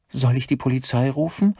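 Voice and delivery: high, uncertain question; nervous secretary. Acted. Secretary asks boss about police, nervous high voice.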